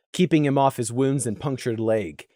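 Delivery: plain reading voice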